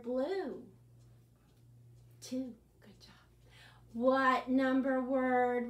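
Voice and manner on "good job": whisper. Acted. Quiet praise after answer.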